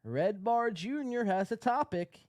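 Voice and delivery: high, loud sneering read-out; mocking John's rant. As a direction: read his message like a whiny rival. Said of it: "He says".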